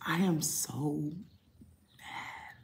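hushed, slow and seething; whispered fury. Real, not acted. Telling viewers about the ruined food, in a slow seething whisper. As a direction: breathe the anger out under the breath.

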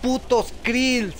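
high, drawn-out amazed outburst; excited. Real, not acted. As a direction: stretch it out in awe. Monstruos aparecen en pantalla. (asombro emocionado)

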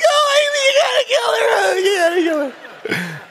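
high, whiny panicked begging; whimpering plea. Retold or imitated. Husband hiding under covers begs wife, high whiny plea. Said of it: "I live like".

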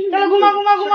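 high crooning "sleep, sleep". Real, not acted. Lulling baby to sleep.

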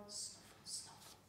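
soft whispered snuffling; hedgehog snuffle. A narrator's reading. Hedgehog in the garden, soft whispered snuffle. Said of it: "went the hedgehog".

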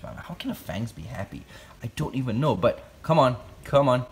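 normal talking voice

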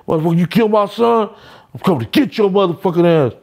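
high, fast mock yelling; angry father. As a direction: voice the furious dad bursting in. Angry father threatens, retold.

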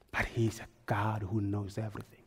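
hushed, breathless whisper. Real, not acted. Preacher hushes the congregation, whispering. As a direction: drop to a whisper for the room. Sermon aside, unvoiced.

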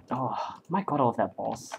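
normal talking voice

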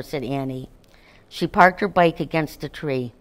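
usual speaking voice

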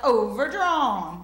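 loud, very slow, drawn-out announcement; bank phone lady. Acted. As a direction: stretch it out loudly like a bank operator. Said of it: "And she's like".

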